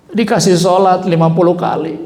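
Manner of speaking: usual speaking voice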